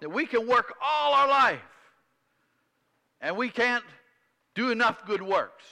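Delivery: loud, high, driving pulpit voice; full-force sermon delivery; forceful preaching. Real, not acted. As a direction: preach it out to the congregation.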